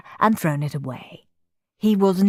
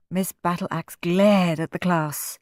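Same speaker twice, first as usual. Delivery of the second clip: ordinary delivery, nothing marked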